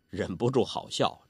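ordinary delivery

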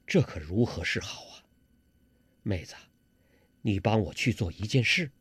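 low, quiet male character voice; hushed, low-pitched worried prince; captive envoy, confiding. A narrator's reading. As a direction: confide gravely in your sweetheart, keeping it down.

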